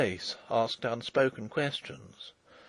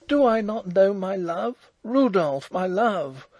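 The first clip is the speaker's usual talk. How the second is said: louder, higher, proudly indignant; 8 semitones higher, 5 dB louder than usual. Acted. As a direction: refuse to be fooled about your love. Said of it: "in superb indignation".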